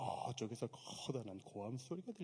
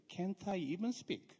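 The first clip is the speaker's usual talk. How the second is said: slow English reading; 5 semitones higher, much slower than usual. Shown as a demonstration. Preacher quoting NIV verse, very slow reading. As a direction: read the English very slowly. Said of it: "엔아이브이 성경은 이렇게 돼 있어요".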